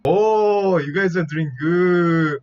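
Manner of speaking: high, loud drawn-out cheer; excited exclamation. Real, not acted. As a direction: cry out with a long, high "Oh". Mock praise of guessing players.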